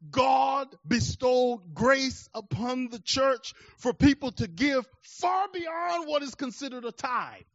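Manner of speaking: loud, high, slow emphatic preaching; impassioned preaching. Acted. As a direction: lean on every word of the promise. Preaching climax.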